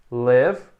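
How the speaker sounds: short clipped i in "live" like "lev". Shown as a demonstration. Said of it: "tem o I curto".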